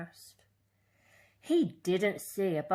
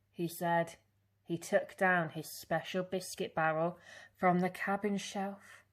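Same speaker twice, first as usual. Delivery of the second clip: usual speaking voice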